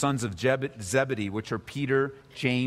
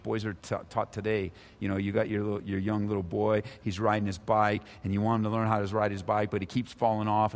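normal talking voice

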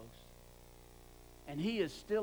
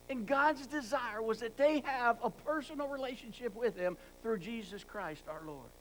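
ordinary talk, nothing marked

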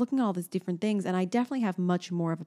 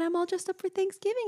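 put-on high, bright talk to a toddler; sing-song mom voice; 13 semitones higher than usual. Mother retelling how she talked to her daughter. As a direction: speak like to a small child. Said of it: "And I said".

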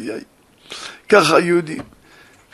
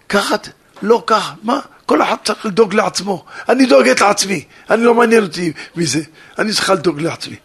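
defiant daughter; 3 semitones higher, 6 dB louder, faster than usual. Retold or imitated. Daughter defies father, leaves home.

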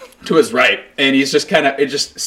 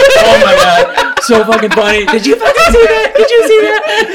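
hysterical laughter; 5 dB louder than usual. Retold or imitated. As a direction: crack up while pointing at him. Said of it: "I'm like".

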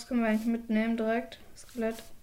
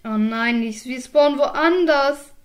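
entsetzter Ausruf; 2 semitones higher, 8 dB louder, slower than usual. Real, not acted. Falscher Spawnpunkt entdeckt.

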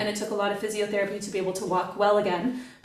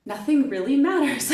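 higher and louder through a laugh; laughing lightly; 4 semitones higher, 3 dB louder than usual. Retold or imitated. To her podcast host, giggling at her own revelation. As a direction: toss it off with a laugh. Sudden sense of humor, laughing.

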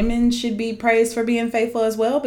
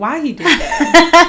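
higher, louder, slowed, laughing through it; laughing disbelief; 8 semitones higher, 7 dB louder, slower than usual. Real, not acted. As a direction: cry out in amused horror.

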